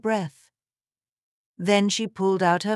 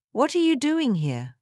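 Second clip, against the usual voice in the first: higher-pitched, mildly irritated question; touch of irritation; 6 semitones higher than usual. A narrator's reading. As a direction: ask it with slight annoyance. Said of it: "with a touch of irritation".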